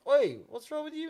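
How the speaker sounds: high, loud challenging "Oi"; calling out. Retold or imitated. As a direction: imitate a mate butting in loudly. Friend accosts him, retold.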